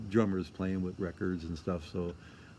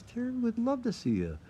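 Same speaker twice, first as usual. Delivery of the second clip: higher, lightly voiced friend inviting him back; warm invitation; 13 semitones higher than usual. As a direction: lightly play the friendly bandmate.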